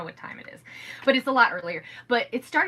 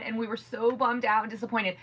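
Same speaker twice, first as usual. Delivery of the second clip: usual speaking voice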